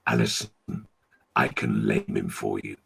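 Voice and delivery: a deep beast voice from below; talking devil dog. Acted. The devil's dog tempting Alison, deep and dark. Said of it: "and the dog talked".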